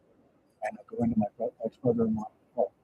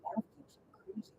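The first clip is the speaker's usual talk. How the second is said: the neighbor's words whispered; half-whispered gossip; retold whisper; 4 semitones higher, 15 dB quieter than usual. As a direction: hush it like a worried secret. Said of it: "she says".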